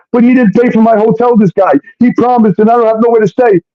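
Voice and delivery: high, rushed put-on complaint; high-pitched mimicry of someone protesting; mock protesting woman. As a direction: imitate a pleading excuse way up in pitch.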